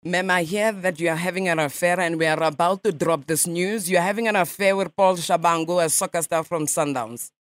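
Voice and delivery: a light mock Russian accent, nasal ma'am; radio prank caller in a lightly faked Russian accent; put-on Russian accent. Prankster calls a stranger as a Russian journalist, mock Russian accent. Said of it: "I speak Russian".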